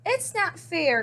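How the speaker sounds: understated wail of unfairness; mild complaint. A narrator's reading. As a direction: complain it isn't fair. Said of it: "cried Hedgehog".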